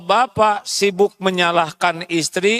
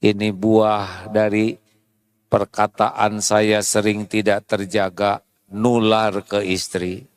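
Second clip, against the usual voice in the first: lower, hushed confession to God; softly repentant prayer; 11 semitones lower than usual. Retold or imitated. Husband quietly confessing his careless words to God.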